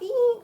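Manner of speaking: high little shocked squeak; squeal of dismay. Retold or imitated. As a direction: squeak at the calorie count. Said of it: "So I was like".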